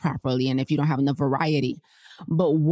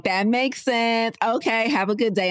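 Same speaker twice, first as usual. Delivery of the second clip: high, slow and sugary okay; overly polite to the guard, drawn out; sweet compliance; 6 semitones higher than usual. Retold or imitated. Answering the guard with exaggerated niceness, high and slow. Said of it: "I'm like".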